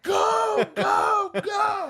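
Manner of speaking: high desperate yelling of go go go; panicked yelling. Retold or imitated. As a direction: scream at her to stop rolling the car. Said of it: "And I'm like".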